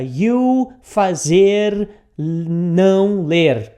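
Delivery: very slow and loud, word by word; mock literal translation. Shown as a demonstration. Teacher showing how not to translate it, slow and laboured. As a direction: stretch each word of a clumsy literal translation. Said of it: "Então não é aqui".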